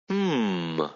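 soft coo through a mouthful; mouth full. A narrator's reading. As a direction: hum with the mouth full of food. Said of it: "Haley cooed with his mouth full".

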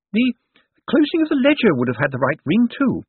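put-on high-pitched old lady voice; Topsy Lavish. A narrator's reading. Dead woman excuses herself to Death, high and pert.

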